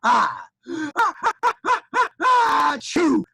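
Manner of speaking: loud, high build-up of ahs to an explosive achoo; hammed-up, shouted dragon sneeze; gigantic sneeze. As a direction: fake an enormous sneeze. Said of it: "a gigantic rocket ship sneeze".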